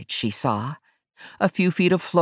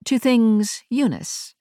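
slow, high-pitched Englishwoman; English accent; 9 semitones higher, slower than usual. A narrator's reading. Ash answers Verity's question, slow English accent. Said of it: "a woman with an English accent".

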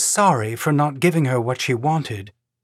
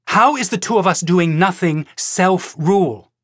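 heated challenge; 6 semitones higher, 3 dB louder than usual. A narrator's reading. Heated challenge in narrated argument.

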